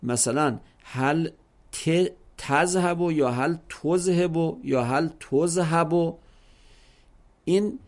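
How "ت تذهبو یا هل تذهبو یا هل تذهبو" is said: slow takes of تذهبو changing the inner vowels; careful vowel variants. Shown as a demonstration. Teacher demonstrating full vowelling of every letter, slowly. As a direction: read each تذهبو slowly with different vowels. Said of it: "اولش هم بگو، وسطش هم بگو".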